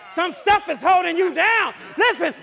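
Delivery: high, loud pulpit urging; full-force preaching. Real, not acted. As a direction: press it home to the congregation.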